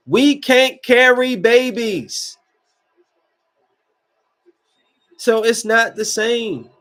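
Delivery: heated, high-pitched insistence; worked-up outburst. Real, not acted. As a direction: hammer it home in disbelief. Heated rant about pregnancy.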